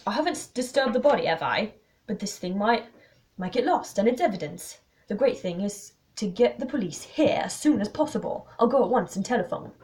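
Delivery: urgent, lightly voiced protest; defensive man. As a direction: brush off the rebuke and take charge. Voices Desmond.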